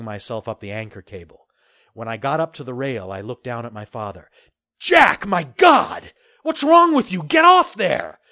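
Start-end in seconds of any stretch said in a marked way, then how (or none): 4.8-8.2 loud high alarm; alarmed scolding. Acted. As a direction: scold him loudly and high. Father scolding son on ship.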